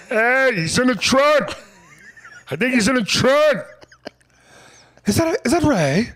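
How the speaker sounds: high, loud mock bystander calling. Comedian voicing a passerby who finds Ray in a trunk, high and loud. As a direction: shout that someone's in the trunk.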